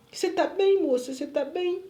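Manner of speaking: acted anxious checking; retold concerned question. Retold nurse checking on her.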